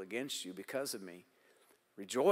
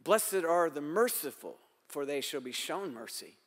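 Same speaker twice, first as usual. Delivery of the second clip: emphatic preaching; 4 semitones higher, 4 dB louder than usual. Acted.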